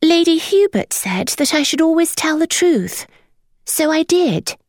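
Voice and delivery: put-on high boy's voice, a little sheepish; fidgety boy. A narrator's reading. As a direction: confess as if it's obvious.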